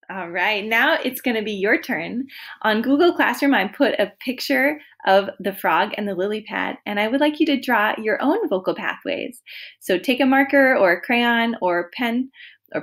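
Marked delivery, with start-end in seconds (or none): none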